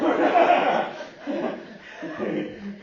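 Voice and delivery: loud high burst of laughter; big high laugh. Real, not acted. Pastor laughing loudly at the man's reply.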